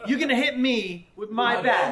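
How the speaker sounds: loud, high mock outrage; really mad. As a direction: act out an intruder's indignant shout, stretched out for laughs. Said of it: "And then he's really mad".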